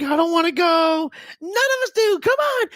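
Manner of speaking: very high whining wail; hammed-up high-pitched pleading; childish protest. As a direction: whine like a kid refusing school. Reluctant new teacher protests to mom.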